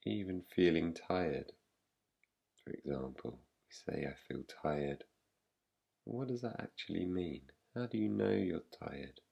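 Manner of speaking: soft, slightly low voice with long pauses; guided meditation. Real, not acted. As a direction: guide them gently into rest, leaving long silences. Leads a yoga nidra practice.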